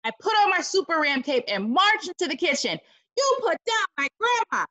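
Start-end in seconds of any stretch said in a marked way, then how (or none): 3.2-4.7 high heroic yell; hero's yell. Acted. Boy in a cape yells at the octopus, high and heroic. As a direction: yell it like a caped hero. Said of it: "I yelled".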